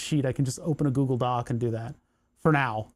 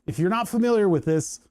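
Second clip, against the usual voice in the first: ordinary talk, nothing marked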